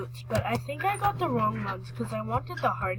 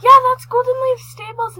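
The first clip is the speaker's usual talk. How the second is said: high, loud, thrilled; excited; 11 semitones higher, 12 dB louder than usual. Real, not acted. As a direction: burst out with delight. Recognizes the stables excitedly.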